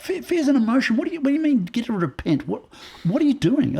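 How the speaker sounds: high, loud, incredulous questioning; replayed outburst of protest; indignant protest. Acts out arguing with guest.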